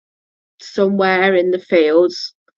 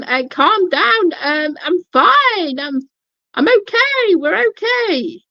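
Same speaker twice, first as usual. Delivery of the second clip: reassuring; 10 semitones higher than usual. Retold or imitated. Soothing the panicked partner.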